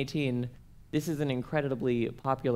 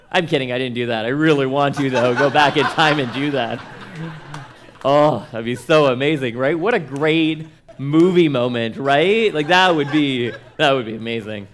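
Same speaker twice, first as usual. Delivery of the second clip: much louder, laughing through an excited daydream; loud excited gushing; 9 dB louder than usual. Real, not acted. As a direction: gush loudly about the great movie moment.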